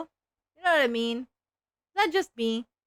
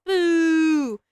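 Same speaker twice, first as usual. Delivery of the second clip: mock boo; 6 semitones higher, 8 dB louder than usual. Booing the ad break.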